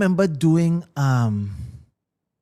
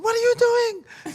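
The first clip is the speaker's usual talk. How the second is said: upset crowd. Retold or imitated. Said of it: "the people now so upset with him".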